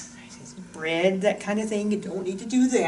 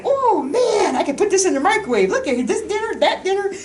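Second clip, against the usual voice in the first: high, loud, fast laughing glee; excited young soldier; 11 semitones higher, 5 dB louder than usual. Retold or imitated. Past self thrilled by microwave dinners, laughing glee. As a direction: gush like a kid let loose at the PX.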